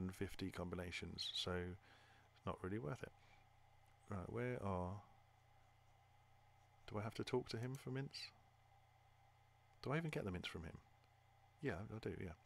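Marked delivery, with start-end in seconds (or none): none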